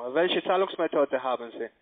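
usual speaking voice

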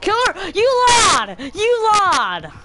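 high furious shout at killer; angry yelling. Real, not acted. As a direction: yell an accusation.

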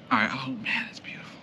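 breathless whispered awe at the view; hushed wonder, barely voiced; awed whisper. Real, not acted.

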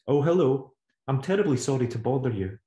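put-on the boy putting on his best manners; overly polite. Boy apologising to a stranger on the phone, overly polite. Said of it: "It often made her overly polite".

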